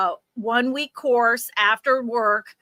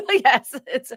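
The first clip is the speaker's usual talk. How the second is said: giggling out the joke, words broken by laughs; cracking up mid-sentence; laughing; 3 semitones higher, 4 dB louder, slower than usual. Real, not acted. Joking about cat's subscription, laughing.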